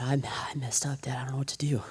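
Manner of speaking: breathless whisper, barely voiced; whispered confession. Confessing to his father.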